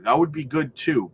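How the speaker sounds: normal talking voice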